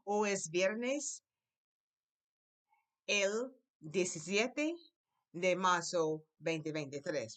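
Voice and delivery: very slow, each word drawn out; slow model sentence. Shown as a demonstration. Teacher repeats the date slowly for the class. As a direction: lay it out slowly for learners. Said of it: "One more time".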